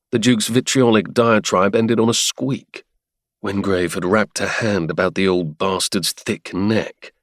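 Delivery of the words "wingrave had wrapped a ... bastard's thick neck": ordinary talk, nothing marked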